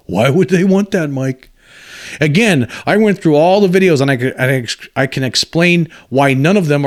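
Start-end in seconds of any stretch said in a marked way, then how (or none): 0.1-1.5 sarcastic repeat with a laugh, in a changed voice; mocking echo. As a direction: throw the question back at him, amused and mocking.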